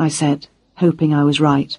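plain reading voice

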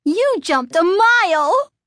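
high, loud, slow gleeful taunt; gleeful exclaim. A narrator's reading. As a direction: crow with delight. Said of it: "Lindy exclaimed gleefully".

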